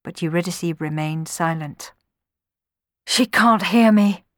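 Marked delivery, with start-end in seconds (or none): 3.1-4.2 slow, loud, higher lament; groaning despair. A narrator's reading. Orpheus beside lifeless Eurydice, groaning in despair. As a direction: groan it out slowly. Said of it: "groaned Orpheus".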